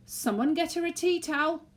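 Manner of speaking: usual speaking voice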